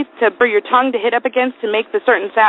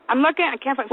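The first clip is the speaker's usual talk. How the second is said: ordinary talk, nothing marked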